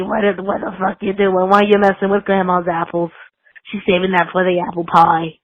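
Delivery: scolding raspy grandma; raspy old grandma scolding Timmy; smoker grandma voice. Pretend. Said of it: "It's the perfect voice".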